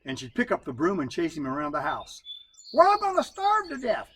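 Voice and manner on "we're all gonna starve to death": much higher and louder holler; hollering wife. Acted. Wife chasing husband with a broom, hollering high and loud. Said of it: "she hollered".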